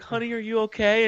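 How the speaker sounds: lightly voiced worried mother; a hint of a caring mom; mother checking on her daughter, gently. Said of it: "she's like".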